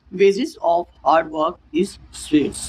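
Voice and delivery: very slow, word by word; teacher dictating slowly; slow example read. Shown as a demonstration. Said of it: "इसका example क्या है".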